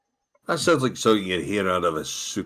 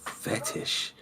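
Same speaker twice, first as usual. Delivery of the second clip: whispered word to yourself; very soft, voiceless aside; saying to oneself; 9 dB quieter than usual. Acted. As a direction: whisper it privately, as if musing aloud. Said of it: "just sort of saying to myself".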